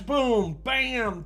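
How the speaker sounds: ordinary talk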